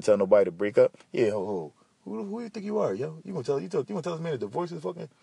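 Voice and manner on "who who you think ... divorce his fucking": high, hushed sneering challenge; squeaky mocking heckler; mock critic voice. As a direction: throw back an imagined listener's jab. Mocking imagined critic's retort.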